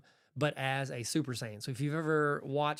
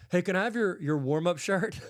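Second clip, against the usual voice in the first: put-on higher, louder kid's request; imitated eager kid; 4 semitones higher, 5 dB louder than usual. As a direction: ask like a hopeful kid, pitched up. Retelling kid's request to a player.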